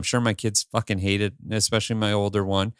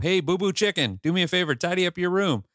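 high, playful mock call upstairs; playful yell up; 9 semitones higher than usual. Dad teasing kids into tidying, playful imitated call. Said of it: "I'll, I'll yell up".

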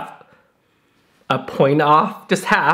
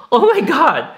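surprised gasp; 11 semitones higher, 4 dB louder than usual. Real, not acted. Reacting to the iron gathering hair.

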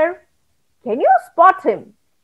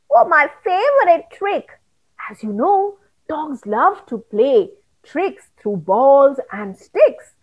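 usual speaking voice

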